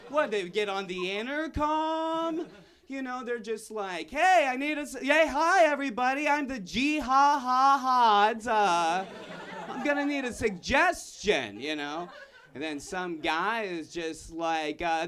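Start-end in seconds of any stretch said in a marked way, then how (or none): 4.1-11.6 mock improv host.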